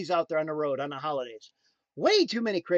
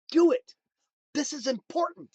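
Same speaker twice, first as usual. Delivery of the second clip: higher, emphatic voice of a calling; urgent inner calling; 8 semitones higher, 3 dB louder than usual. Retold or imitated. A calling urging its owner to act, urgent. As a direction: open on a loud command, then press the point. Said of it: "My calling said".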